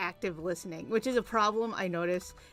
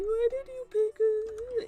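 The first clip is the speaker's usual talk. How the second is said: shrill mock boss, much higher and slow; 8 dB louder, slower than usual. Mocking her ex-boss's late-night call in a much higher, slow voice. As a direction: lampoon the boss as whiny and high.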